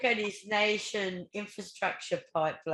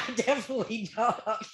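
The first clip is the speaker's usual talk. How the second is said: higher-pitched, amused reply breaking with laughs; laughing; 4 semitones higher than usual. Real, not acted. Co-host agreeing about the potholes, laughing. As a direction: answer through real laughter. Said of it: "I'm sorry for laughing".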